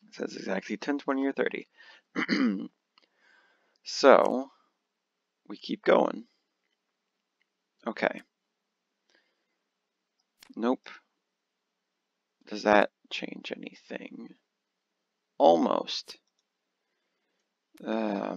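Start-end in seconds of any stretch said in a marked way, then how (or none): none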